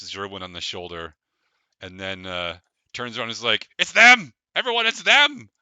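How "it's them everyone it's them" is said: loud, high, thrilled yelling to the crowd; excited shout. Acted. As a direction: announce them to the whole party at full voice.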